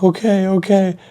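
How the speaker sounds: high, hasty giving-in; flustered surrender. Retold or imitated. Kid told to get out by manager, high flustered surrender.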